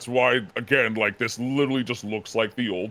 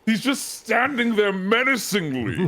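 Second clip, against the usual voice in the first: high, loud burst of amused agreement; excited exclamation; 10 semitones higher, 5 dB louder than usual. Real, not acted. Fan telling co-host about the toy on the desk, excited and high. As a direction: cry it out, delighted to be understood.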